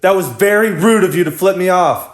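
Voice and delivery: high, indignant telling-off; mock-offended woman, high voice; imitated scolding girl.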